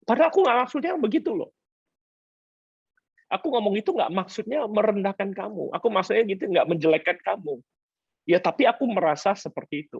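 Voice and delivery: mock pleading husband. Voicing conciliatory husband.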